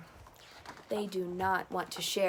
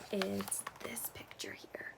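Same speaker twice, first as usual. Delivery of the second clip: whispering; 12 dB quieter than usual. Real, not acted. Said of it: "whisper aside".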